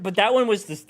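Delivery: ordinary talk, nothing marked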